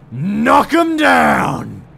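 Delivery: drawn-out, high, loud cheer; triumphant shout. Real, not acted. Player celebrating knocking them down like bowling pins, triumphant shout. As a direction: yell it in triumph, stretching the words.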